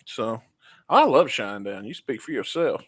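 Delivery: normal talking voice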